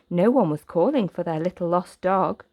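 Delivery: ordinary delivery, nothing marked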